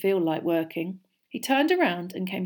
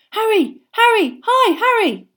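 eager fan calling; 11 dB louder than usual. A narrator's reading. Eager fan calling his hero.

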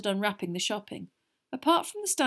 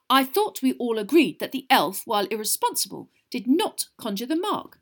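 mildly heated, raised-voice objection; 4 semitones higher, 6 dB louder than usual. A narrator's reading. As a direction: object hotly, starting high and loud. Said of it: "said Percy hotly".

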